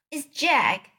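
high frightened bellow. A narrator's reading. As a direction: shout the name out in dread. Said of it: "bellowed the flesh lampeter".

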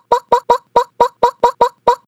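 loud high popping noises; popping sounds. Acted. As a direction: pop pop pop, bursting loud. Frog belly popping.

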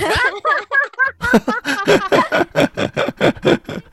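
loud, high burst of laughter. Real, not acted. Reacts to weight gain.

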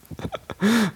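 bursts out in a high laugh; high-pitched giggle. Real, not acted. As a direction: laugh helplessly at the memory. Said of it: "我快笑死".

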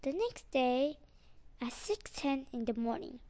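ordinary delivery, nothing marked

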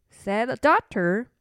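usual speaking voice

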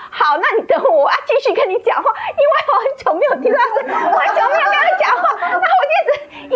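声音高、带笑、语速快; 像压不住喜悦一样边笑边说; 兴奋又笑. Retold or imitated. Retelling joyful talk with God. (向上帝倾诉时又哭又笑的兴奋)

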